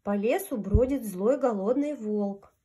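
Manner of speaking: plain reading voice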